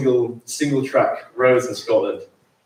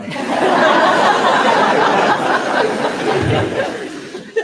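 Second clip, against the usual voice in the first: high airy laugh bursting out; long breathy laugh; 5 dB louder than usual. Real, not acted. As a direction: laugh out loud at the happy memory. Memory of running on beach.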